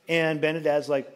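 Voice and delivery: ordinary talk, nothing marked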